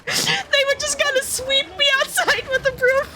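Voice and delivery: laughing hard. Real, not acted. Picturing security sweeping her out with the broom, laughing. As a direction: crack up as you tell it.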